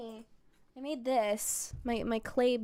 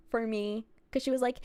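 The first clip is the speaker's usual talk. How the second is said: normal talking voice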